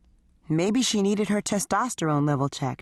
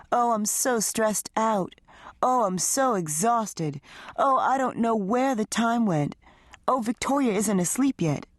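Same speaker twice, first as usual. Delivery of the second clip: higher, slow, whiny mimicry of his wife; mocking female voice; 6 semitones higher than usual. Husband mocking his wife's excuses, higher sing-song mimicry. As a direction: ham up her tired excuses to belittle them. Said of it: "mocking Sydney's usual excuses in a female tone of voice".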